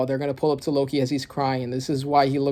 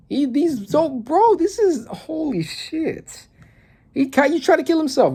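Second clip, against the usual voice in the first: high, real shocked outburst; shocked realization; 9 semitones higher than usual. Real, not acted. Reader realizes Loki tried to kill himself, shocked. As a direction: voice jumps up in disbelief. Sudden realization about manga character.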